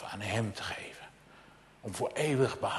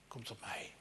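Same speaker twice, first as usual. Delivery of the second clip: whisper; 16 dB quieter than usual. Acted. Voicing Jesus' invitation.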